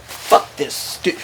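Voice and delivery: whispered, slow and dismissive; under your breath, brushing him off slowly; muttered aside. Retold or imitated. Lying on his cot in Iraq, a slow whispered dismissal.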